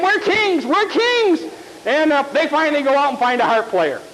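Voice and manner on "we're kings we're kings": fuming kings. Acted. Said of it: "they're fuming".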